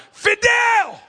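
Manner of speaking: loud, high holler of a name; hollered call. Retold or imitated. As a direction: call out to a friend across the street. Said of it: "And I hollered".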